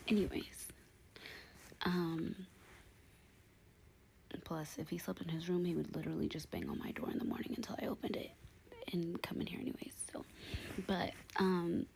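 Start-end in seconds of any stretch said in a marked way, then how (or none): 4.4-10.2 whispering. Real, not acted. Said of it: "I'm whispering because my baby's asleep".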